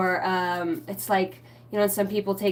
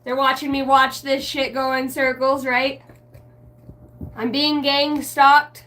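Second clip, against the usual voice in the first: higher, louder mock paranoia; paranoid rant; 5 semitones higher, 4 dB louder than usual. Streamer mimicking her own paranoid thoughts, higher and louder. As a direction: play the panicked conspiracy nut. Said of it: "I'm like".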